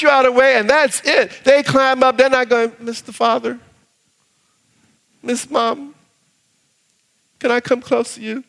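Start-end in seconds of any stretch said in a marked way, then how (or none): 2.8-8.4 stiff timid request. Pretend. Mock formal child request.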